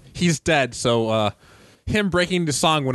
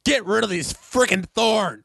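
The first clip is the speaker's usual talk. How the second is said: acted yell in a raised pitch; mock yell; 9 semitones higher than usual. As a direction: yell it as an exasperated command, half-restrained. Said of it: "[name] yells".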